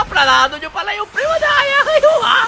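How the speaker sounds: ordinary talk, nothing marked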